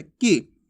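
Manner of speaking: की said briefly, short "i"; short vowel. Shown as a demonstration. Teacher demonstrating short i to learners, brief. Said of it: "इसलिए कम समय लगेगा इसमें".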